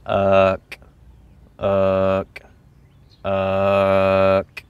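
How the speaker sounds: "Uck" low and drawn out, crisp K at the end; emphasized final K. Shown as a demonstration. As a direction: hold "Uck" on a low note and click the K. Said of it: "emphasize that K sound".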